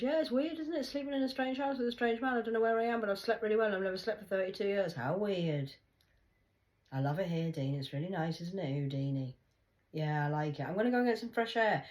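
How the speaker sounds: high, loud, fake-cheerful; sarcastic sweet voice. A speaker quoting herself to Dean, high and fake-cheerful. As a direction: sing it out with mock innocence. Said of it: "I said".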